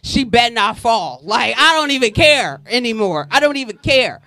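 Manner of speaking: loud, resigned don't-care; giving up. Retold or imitated. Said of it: "I was just like".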